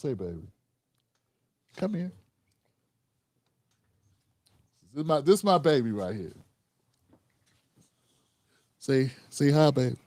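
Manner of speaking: soft, tender voice for his little one. Real, not acted. Greets his little child on camera.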